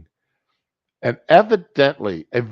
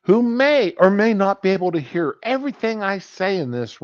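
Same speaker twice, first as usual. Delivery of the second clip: high-pitched, acted objection; mock indignant protest; 9 semitones higher than usual. Patient warning doctor the receptionist may overhear, mock indignant. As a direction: press the point with voice held high.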